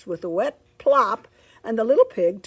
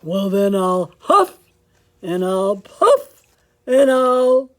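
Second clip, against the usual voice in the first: loud wolf threat; 11 dB louder, slower than usual. Acted.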